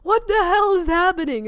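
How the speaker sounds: retold cry of disbelief, high and loud; bewildered outburst. Visitor reacting to everyone screaming his name, bewildered.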